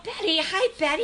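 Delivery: high, loud delighted hello; excited greeting. Real, not acted. Host greets Betty as she rejoins, excited. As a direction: call out a big happy hello.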